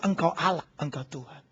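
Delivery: whisper-soft worship after the shout; reverent worship. Acted. Israelites on their knees, hushed worship. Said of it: "mulut mereka berkata".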